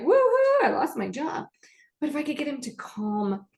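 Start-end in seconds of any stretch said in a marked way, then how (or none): 0.0-1.5 a high, loud whoop, then the rest tossed off; mock cheer. Therapist mocking an unlikely happy reaction to getting fired. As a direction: cheer "Woohoo" like losing the job is a party. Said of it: "I don't think he was gonna be like".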